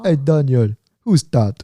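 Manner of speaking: lightly imitated Jamaican accent; badly faked Jamaican accent, curious and teasing; terrible Jamaican accent. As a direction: have a clumsy go at a Jamaican accent. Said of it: "this is a terrible Jamaican accent".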